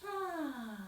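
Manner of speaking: high, falling dreamy "ah"; long adoring sigh, sliding down; lovely sigh. Shown as a demonstration. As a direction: sigh for someone lovely and kind. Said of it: "lovely and kind".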